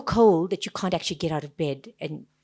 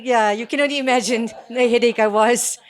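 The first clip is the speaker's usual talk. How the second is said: normal talking voice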